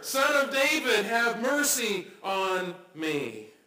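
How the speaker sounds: loud cry, then slow pleading. Retold or imitated. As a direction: cry out above the crowd, then draw out the plea. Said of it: "he says even louder".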